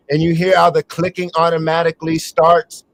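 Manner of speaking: ordinary talk, nothing marked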